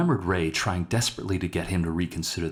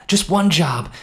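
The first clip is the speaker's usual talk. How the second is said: higher and louder, insisting; pushy plea; 10 semitones higher, 9 dB louder than usual. Acted. Henry pressing Ray to take the job, loud and insistent. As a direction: push the second ask hard. Second take, pushed harder.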